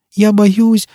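high, timid little voice; small child pitched high; frightened child. A narrator's reading. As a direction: whimper it like a scared child. Child afraid of Foyle.

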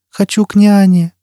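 put-on high child's whine; whining child. A narrator's reading. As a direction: insist like a frightened small child. Child wants nanny.

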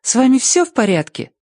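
high-pitched happy concern; delighted girl checking on a friend; joyful. A narrator's reading. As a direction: ask gladly in a very high voice. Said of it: "радостно сказала дверь".

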